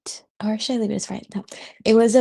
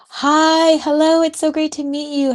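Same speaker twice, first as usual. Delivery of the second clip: excited; 9 semitones higher, 4 dB louder than usual. Real, not acted. Greeting live viewers brightly.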